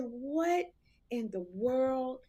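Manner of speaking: drawn-out shocked disbelief; retold disbelief. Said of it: "And I said".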